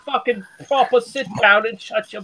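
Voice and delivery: hammed-up posh Londoner, sneering; lightly done upper-class English voice, mocking; mock posh English accent. Taunting the Scottish friend in a mock posh English accent.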